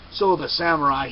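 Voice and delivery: plain reading voice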